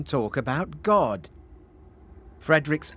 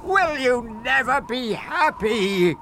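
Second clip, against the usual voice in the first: high, drawn-out exasperated question; exasperated sigh; 11 semitones higher, 4 dB louder, slower than usual. Acted. Magic fish tires of Frederick's wishes, exasperated. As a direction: draw it out in loud exasperation. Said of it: "it sighed".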